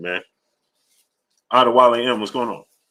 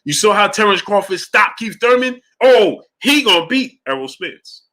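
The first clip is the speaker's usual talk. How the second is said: hyped fan; 7 semitones higher, 5 dB louder than usual. Retold or imitated. Fan convinced after the stoppage, hammed-up excitement. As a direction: sell the fan getting carried away. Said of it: "you're gonna watch".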